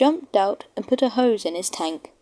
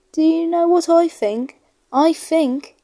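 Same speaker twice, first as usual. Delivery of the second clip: put-on higher, louder boy's exclamation; a boy amazed on the platform; excited little boy; 7 semitones higher, 4 dB louder than usual. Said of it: "he went on".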